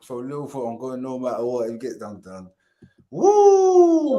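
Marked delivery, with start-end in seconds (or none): 3.1-4.2 high, loud whoop of joy; excited whoop. Real, not acted. Fan whooping about going to the final, loud and high. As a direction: let out a big whoop.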